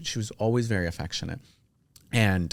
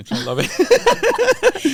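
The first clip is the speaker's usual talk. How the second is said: drawn-out, much higher, louder squeal through a laugh; giddy squealing laugh; 9 dB louder, much slower than usual. Real, not acted. Sniffing his shirt to her friend, a slow squealing laugh. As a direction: melt with giddy delight, laughing. Swooning over shirt's smell.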